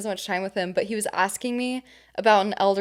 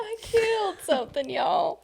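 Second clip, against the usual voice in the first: high, quieter twangy echo; Natalie; 9 semitones higher, 4 dB quieter than usual. Retold or imitated. Co-host copying castaway's confession, high mock dismay. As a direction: echo the impression in a high drawl. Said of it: "She's like".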